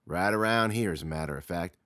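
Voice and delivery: ordinary delivery, nothing marked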